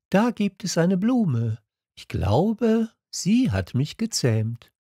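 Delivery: high, wondering prince voice; little prince. A narrator's reading. Little prince telling the fox of a flower, high voice. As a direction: speak as a small prince, pitched well up.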